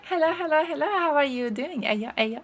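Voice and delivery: high sing-song hellos; excited greeting. Real, not acted. Streamer calling hello to a new chatter, high and bright. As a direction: greet them up high and bouncy.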